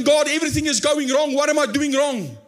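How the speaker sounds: high, pleading cry for help; crying out to God. Retold or imitated. Pastor voicing a desperate believer crying out, pleading high. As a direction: beg for an answer, voice up high. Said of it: "I'm actually crying out".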